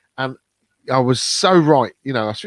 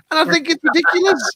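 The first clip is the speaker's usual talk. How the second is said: exasperated. Real, not acted. Exasperated about toilet rules.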